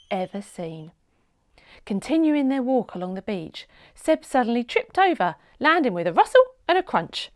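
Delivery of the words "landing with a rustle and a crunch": usual speaking voice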